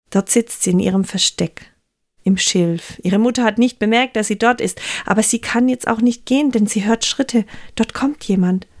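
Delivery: ordinary delivery, nothing marked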